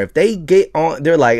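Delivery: ordinary delivery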